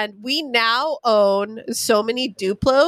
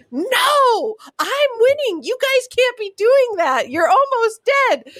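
put-on much higher, whiny frustrated girl; upset kid; 12 semitones higher, slower than usual. Niece protesting her cousins resetting in laser tag, high whiny wail. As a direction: protest like a kid losing a game. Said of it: "She's just like".

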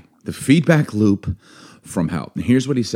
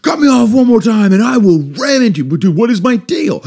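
high, loud hammed-up fury at yourself; hammed-up hothead yelling at himself in traffic; mock road rage; 10 semitones higher, 4 dB louder than usual. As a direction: rant like a hothead stuck in traffic. Acted road-rage self talk.

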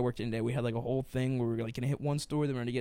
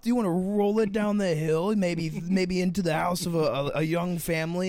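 much higher, louder hammed-up host; game show host; 5 semitones higher, 5 dB louder than usual. Pretending to be the host offering grim options, loud and high. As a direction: pitch it like an over-the-top TV host. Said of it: "I think it'd be like".

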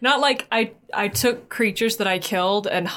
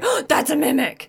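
mock alarm; 8 semitones higher than usual. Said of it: "we would be like".

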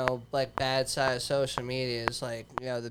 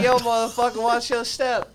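loud, high street-tough talking plant; 9 semitones higher, 5 dB louder than usual. Acted.

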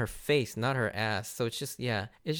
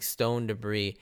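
ordinary talk, nothing marked

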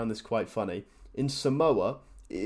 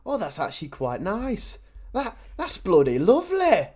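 mock posh British accent, pitch climbing much higher; hammed-up delighted Brit in a British accent; British impression; 9 semitones higher, 4 dB louder than usual. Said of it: "Love British people".

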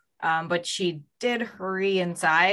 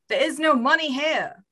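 high-pitched put-on shout; shouting back; 7 semitones higher than usual. A narrator's reading. Storyteller as victim answering the gunman, shouting back. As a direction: call it back in a raised character voice. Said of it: "Blake kept shouting back".

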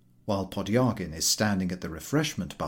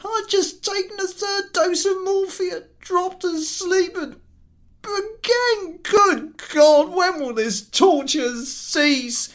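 anguished invalid; 7 dB louder than usual. Acted. Invalid to the collector, anguished. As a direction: beg for release from torture.

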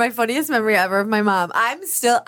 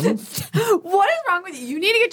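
laughing disbelief; 10 semitones higher, much faster than usual. Real, not acted. Reacting to third fall.

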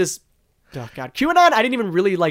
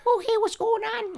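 put-on high, thin old man whine; Sandler old man voice; 8 dB quieter than usual. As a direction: squeak it like a goofy old man. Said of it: "like an old man kind of voice".

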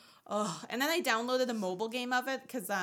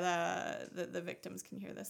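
usual speaking voice

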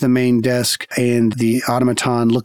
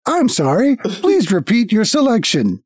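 trolling automaton; 6 semitones higher than usual. Acted. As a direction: feign machine politeness while mishearing. Automaton keeps mishearing.

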